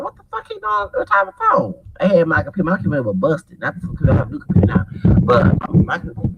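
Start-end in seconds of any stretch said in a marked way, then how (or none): 0.0-1.7 high, incredulous retold question; shocked disbelief. Narrator reacting to the phone's price, high and stunned. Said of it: "I'm like".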